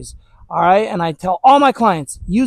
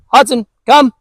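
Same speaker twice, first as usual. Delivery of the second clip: louder and higher call of the dog's name; 4 semitones higher, 4 dB louder than usual. Real, not acted. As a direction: pull the dog away from the bush with your voice. Calling distracted dog.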